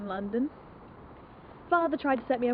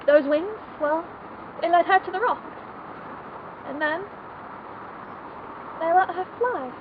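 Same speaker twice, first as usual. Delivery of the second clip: impassioned lament; 5 semitones higher, 3 dB louder than usual. Acted. Bitter climax of the tragic tale.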